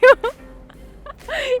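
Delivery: loud, very high laugh; real fit of laughter. Real, not acted. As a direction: completely crack up. Cracks up between takes. (громкий хохот на высокой ноте)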